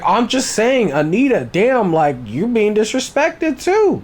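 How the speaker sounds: high, loud gleeful goading; laughing-through-it instigator; egging on. Retold or imitated. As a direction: needle her into a fight, grinning. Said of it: "He's like".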